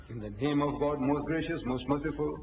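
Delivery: low, level intoning; sacred recitation. Shown as a demonstration. Speaker answering whose name the Prophet speaks in, solemnly intoned. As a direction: intone it like a repeated litany.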